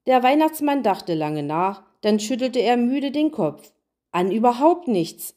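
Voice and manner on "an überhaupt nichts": plain reading voice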